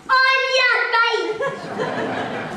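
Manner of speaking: high, slow and teasing; mocking drawl. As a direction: stretch it out with a laugh. Mocking the evicted Jack.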